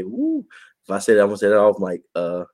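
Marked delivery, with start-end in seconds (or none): 0.0-0.4 high, flirty woo; a cheeky little admiring call; jokey woo held off mic; playful woo. Pretend. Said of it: "I ain't gonna say".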